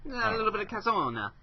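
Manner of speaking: high-pitched mock Italian; Italian accent. Half-Italian co-host joking about heritage, high Italian accent. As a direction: put on a cartoon Italian voice. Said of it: "Yeah, half Italian".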